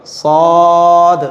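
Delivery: "सौद" held very long with full rounded vowel; pronunciation demo. Shown as a demonstration. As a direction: stretch out "सौद" slowly on a level tone. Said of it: "side के दांतों से लगेगा". ("सौद" को खींचकर मोटा पढ़ें)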